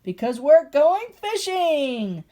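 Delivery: big booming roar, slowed right down. Acted. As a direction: roar it out with joy. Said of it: "Then he roared".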